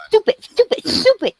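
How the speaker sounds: a hint of Ronan's jeering voice; mocking Ronan. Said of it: "mock somebody's accent or how they speak".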